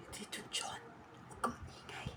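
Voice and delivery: breathy hushed whisper, barely audible. Real, not acted. Whispering to John John to keep quiet. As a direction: hush him so nobody hears. Hushing a friend during the skit.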